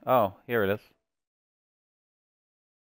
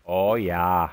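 drawn-out Canadian "oh, yah"; slow, rounded Canadian accent; 3 semitones lower than usual. Acted. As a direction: stretch it out in a put-on Canadian accent. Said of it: "That's my Canadian, by the way".

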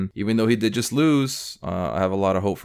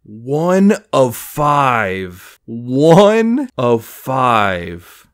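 very slow, much louder, savoring; awed announcement; 3 semitones higher, 10 dB louder, much slower than usual. Real, not acted. Collector announcing a one-of-five card, slow and loud. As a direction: drag out the number in triumph.

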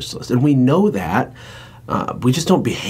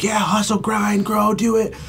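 much higher, pumped-up hype; hyped cheer; 11 semitones higher than usual. Retold or imitated. Cheering on a friend with a pumped high hype. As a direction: cheer him on like a hype man. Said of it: "and I'm like".

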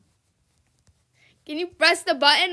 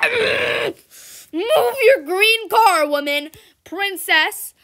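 higher, louder, very slow move your green car; drawn-out yell; 4 semitones higher, 8 dB louder, much slower than usual. Real, not acted. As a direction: yell it long at the other driver. Yelling at another player.

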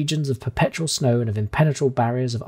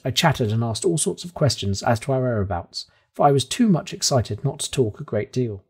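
plain reading voice

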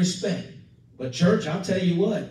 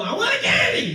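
put-on whiny child; 13 semitones higher than usual. Preacher mimicking a child demanding candy, high whine. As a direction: beg like a kid in the store. Child begging in grocery store.

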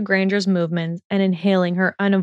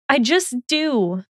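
sputtered; 6 semitones higher, slower than usual. A narrator's reading. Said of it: "she sputtered".

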